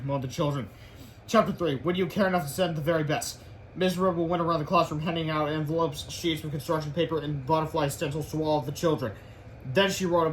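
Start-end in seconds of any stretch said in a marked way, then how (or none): none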